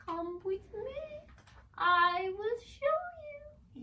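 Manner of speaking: high, slow, beckoning; eerie elf voice. Acted. Tormented elf beckoning Eliza into the drawing, high and slow. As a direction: lure them in gently.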